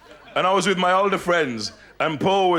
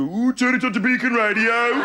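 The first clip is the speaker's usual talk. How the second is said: put-on higher, sing-out Midlands accent; hammed-up Midlands voice, pitched up; Midlands accent slipping in; 6 semitones higher than usual. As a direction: slide up into a comic Midlands accent. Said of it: "my voice starts to go".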